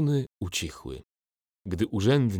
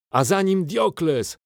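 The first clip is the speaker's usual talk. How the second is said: much higher and louder cheer; yelling; 11 semitones higher, 7 dB louder than usual. A narrator's reading. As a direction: scream over the crowd. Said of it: "wrzasnął Quintus".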